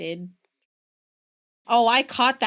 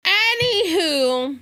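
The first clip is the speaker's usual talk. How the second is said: high, louder and slow; a theatrical breezy anywho; hammed-up anywho; 7 semitones higher, 5 dB louder, slower than usual. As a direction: stretch it out to change the subject. Moving on after the joke.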